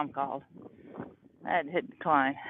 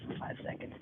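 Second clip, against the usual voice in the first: quiet; 4 semitones lower, 14 dB quieter than usual. Real, not acted. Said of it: "I'm gonna be quiet".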